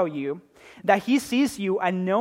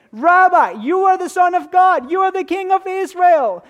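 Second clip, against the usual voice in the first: much higher and louder, bursting with excitement; excited exclamation; 10 semitones higher, 9 dB louder than usual. Shown as a demonstration. Preacher acting Nathanael's awestruck answer to Jesus, excitedly. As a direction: proclaim it like a man whose mind is blown. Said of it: "My impersonation? ... he's excited".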